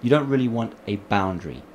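usual speaking voice

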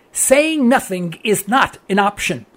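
slow, each word weighted; slow emphatic statement. Real, not acted. Host hammering the point to listeners, slow and emphatic. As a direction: drive the point home slowly.

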